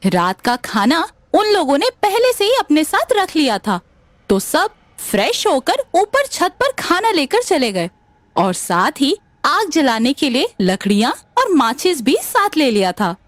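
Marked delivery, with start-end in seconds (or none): none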